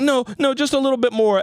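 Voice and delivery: put-on high whiny child begging; pleading kid imitation. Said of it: "he was like".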